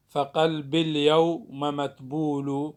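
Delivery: فقلب اليوم read slowly as feqelb iljewm, the ya dropped; slow scansion of فقلب اليوم متبول; metrical pronunciation. Shown as a demonstration. Teacher shows how the dropped letter sounds. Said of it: "يحذف الساكن الأول وهو الياء".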